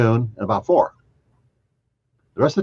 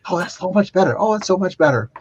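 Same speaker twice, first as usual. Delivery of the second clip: higher, relieved sigh of a voice; relieved lady; 10 semitones higher than usual. Retold or imitated. Patient telling the doctor she feels better, higher relieved voice. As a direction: play her sweet relief, pitched up. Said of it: "she goes".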